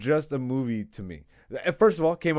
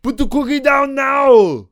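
loud, higher Arnold shouting cop lines; Arnold impression; 6 semitones higher, 6 dB louder than usual. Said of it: "That's actually really good".

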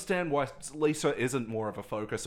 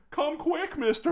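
high calling voice. Retold or imitated. Imitating a character calling out.

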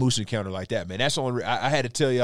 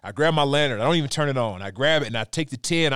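usual speaking voice

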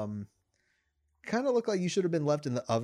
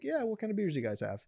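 customer voice; 7 semitones higher, 3 dB quieter, faster than usual. Retold or imitated. Customer asking, retold.

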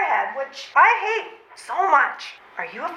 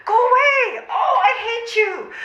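laughing shout; 6 semitones higher than usual. Acted. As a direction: yell go away, half laughing, half crying. Flustered refusal.

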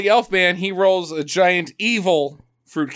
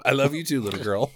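mock hero; 4 semitones lower, 3 dB quieter, faster than usual. [name] answers little girl, low amused mock tenderness. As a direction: lower hero voice with a laugh.

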